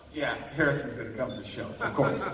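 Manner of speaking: low, dry mock reassurance; dry sarcastic "of course". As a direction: brush it off with deadpan sarcasm. Sarcastic reply to goddaughter.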